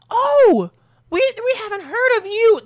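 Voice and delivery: loud, very high mock astonishment; hammed-up squeal of discovery; exclaimed surprise. Hotel staff reacting to a son they never heard of, mock astonishment.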